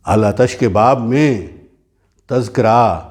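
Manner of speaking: slow declaimed verse, rising openings and falling refrains; ghazal recitation. Acted. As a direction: recite each phrase apart, with long pauses.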